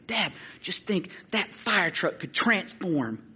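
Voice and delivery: put-on slow, hushed childhood wonder; awestruck kid. Boy to his dad in the car, awestruck. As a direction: let the idea slowly sink in, softly.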